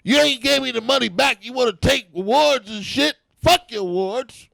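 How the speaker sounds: mock outrage at ungrateful colleges; pretend Bill Cosby rant. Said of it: "Bill Cosby came out and said".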